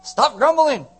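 loud, high shouted order; blunt command. Retold or imitated. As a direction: shut the complaining down, loud and abrupt. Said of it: "He's just like".

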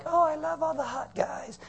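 high falsetto swoon, hammed-up goddess; mock goddess. Pastor mocking fickle Greek goddesses, in a falsetto swoon. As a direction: sigh it out like a lovestruck goddess. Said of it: "women gods who are like".

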